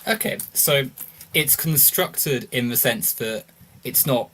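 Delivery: ordinary talk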